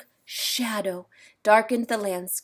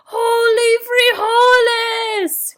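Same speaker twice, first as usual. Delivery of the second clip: high, slow, loud exclamation; exclaiming shout; 12 semitones higher, 10 dB louder, slower than usual. A narrator's reading. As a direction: shout it as the hero strikes. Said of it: "cried Skipito".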